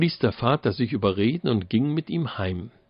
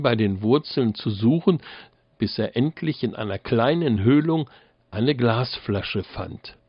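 usual speaking voice

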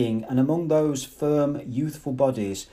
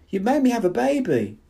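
very high, louder scream over laughter; 11 semitones higher, 4 dB louder than usual. A narrator's reading. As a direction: scream it above the crowd. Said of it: "she screamed above the uproar".

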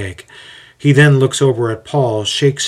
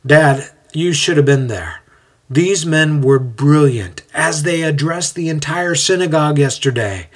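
raised, higher voice to Dad; mildly acted call across the room; 5 semitones higher, 3 dB louder than usual. A narrator's reading. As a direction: call out with enthusiasm. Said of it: "calls out".

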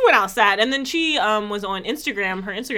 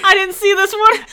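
shocked laugh; 11 semitones higher, 9 dB louder than usual. Real, not acted.

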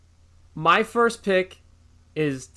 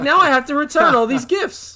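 high, louder exasperated complaint; mock outrage, raised voice; livid mom, retold; 10 semitones higher, 5 dB louder than usual. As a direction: imitate a fed-up mother, pitched way up. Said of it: "like, s-, livid".

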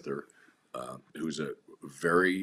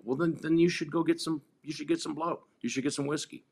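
mock permission; 10 semitones higher, 3 dB louder than usual. Telling addict to go use.

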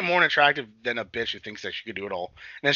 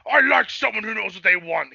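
higher-pitched mock-boisterous Klingon; amused Klingon quote; 9 semitones higher, 4 dB louder than usual. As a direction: imitate a Klingon tickled by a feisty customer. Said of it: "he starts, like, laughing".

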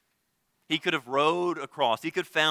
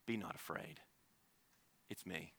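much softer, lower, gentle reassurance; tender comfort; 8 semitones lower, 19 dB quieter than usual. Acted. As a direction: hush a frightened friend. Jesus comforts terrified disciples.

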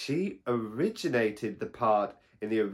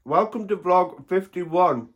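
mouth full; 6 semitones higher, 7 dB louder than usual. Real, not acted. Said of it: "talk with my mouth full".

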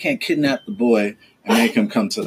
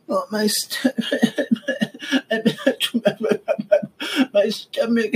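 higher mock-whimpering kid, laughing through it; mock whiny kid; 10 semitones higher, slower than usual. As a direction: imitate a child fake-complaining of a stomach ache, breaking into laughs. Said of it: "there's not gonna be any crying".

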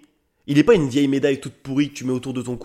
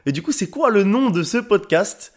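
higher-pitched mock amazement; curious listener's reaction; 6 semitones higher than usual. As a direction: exclaim a wow, then ask eagerly. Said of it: "vous vous dites peut-être".

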